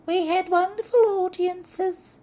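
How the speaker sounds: high, loud falsetto lady; protesting. Acted. Lorraine protesting to her husband, high and loud. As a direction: protest shrilly, hammed up. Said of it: "Lorraine protested".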